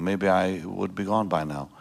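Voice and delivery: ordinary delivery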